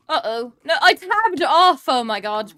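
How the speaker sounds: loud high panicked yell; panicked shout. Real, not acted. Tabbed out mid-game, panic.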